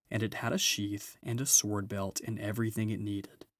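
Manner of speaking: usual speaking voice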